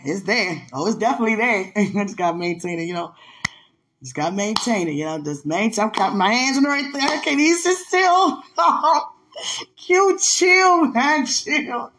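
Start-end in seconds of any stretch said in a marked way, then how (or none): 5.8-11.9 high, loud, bursting with excitement; can't sit still, voice shooting up; excited giggling squeals; hyped up. Real, not acted. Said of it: "See how hyped I am now?".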